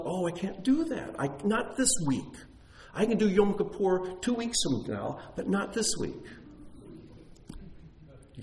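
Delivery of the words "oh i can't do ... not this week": higher, mock-whiny excuse making. Pastor voicing his own logic making excuses, higher and whiny. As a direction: play the self-justifying objector lightly. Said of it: "our logic comes up".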